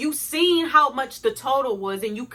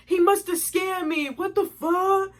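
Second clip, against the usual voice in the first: high, whiny mock voice of a lying boyfriend; mocking boyfriend's lie; 7 semitones higher than usual. Girlfriend mocking her boyfriend's excuse to her viewers, high mock whine. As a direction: play innocent in a high put-on whine. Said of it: "That's all he kept saying".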